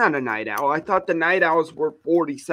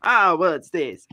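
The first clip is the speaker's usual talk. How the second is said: ordinary delivery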